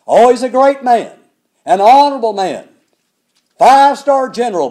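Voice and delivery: loud ringing praise before the turn; loud praise build-up. Shown as a demonstration. Preacher praising the general loudly before the but. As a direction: build him up loud, then undercut it. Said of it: "The Bible puts the emphasis not on how great".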